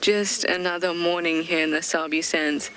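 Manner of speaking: normal talking voice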